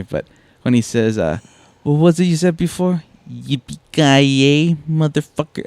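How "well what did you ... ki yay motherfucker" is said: higher, drawn-out villain line; Alan Rickman. Retold or imitated. Podcast guest quoting a favorite villain line, mock menace. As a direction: stretch the yippee-ki-yay in mock villain style. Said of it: "anything that Alan Rickman says is hilarious".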